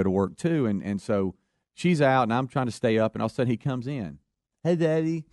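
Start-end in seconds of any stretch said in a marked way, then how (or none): 4.6-5.2 son with bitten tongue. Retold or imitated.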